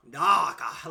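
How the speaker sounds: loud, mock posh British "darker"; England villain imitation. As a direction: ham up a British villain saying it. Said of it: "like a England villain".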